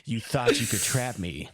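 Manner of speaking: slow, drawn-out gloating; mock triumph. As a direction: gloat like a hammed-up escape artist.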